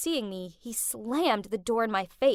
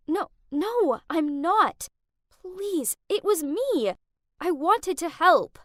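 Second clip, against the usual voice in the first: pleading; 5 semitones higher, 6 dB louder, slower than usual. Acted. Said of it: "I pleaded".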